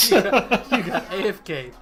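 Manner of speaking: teasing through laughter; laughing. Real, not acted. Game master teases absent player about being away, laughing. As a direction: talk while laughing, starting loud and high.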